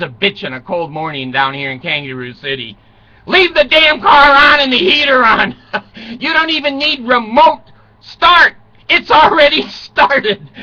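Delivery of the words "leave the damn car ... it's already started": loud punchline. Real, not acted. Punchline advice to viewers.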